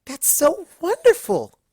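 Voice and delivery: warmly thrilled; delighted Fluttershy. A narrator's reading. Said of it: "warming up to the idea".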